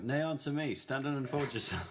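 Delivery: high, loud theatrical challenge; challenging sentry. Acted. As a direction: call out like a sentry in the dark. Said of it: "And the voice comes back".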